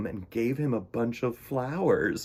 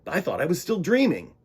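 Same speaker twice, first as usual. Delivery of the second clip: put-on young penguin voice; 7 semitones higher, 5 dB louder than usual. Pete's character voice.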